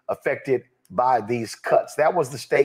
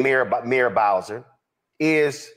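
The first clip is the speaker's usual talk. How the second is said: normal talking voice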